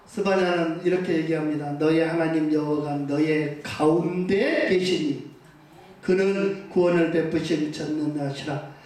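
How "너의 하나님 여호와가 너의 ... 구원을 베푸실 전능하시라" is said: scripture reading. Acted. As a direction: read the verse gravely, a notch lower. Scripture read aloud. (낮고 느린 성경 낭독)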